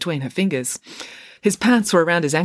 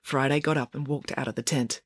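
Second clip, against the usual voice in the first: plain reading voice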